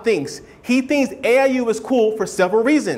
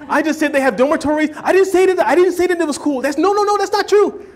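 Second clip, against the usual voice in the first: higher, rising panicky protest; mock flustered denial, much higher; 6 semitones higher than usual. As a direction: backpedal in a hurry, pitch climbing. Acted denial to a friend.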